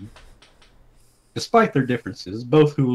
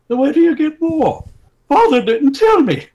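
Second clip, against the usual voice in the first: princess voice; 8 dB louder than usual. Acted. Princess asks father's whereabouts.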